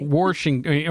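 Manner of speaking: "Washington" said as "Warshington"; intrusive R. Shown as a demonstration. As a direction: throw an R into the first syllable of "Washington". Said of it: "they throw an R in there".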